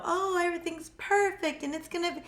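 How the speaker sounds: high, bright fake reassurance; mock cheerful. A psychic mocking the sugarcoated reading she won't give. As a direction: pretend everything's fine, lightly mocking. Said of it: "and be like".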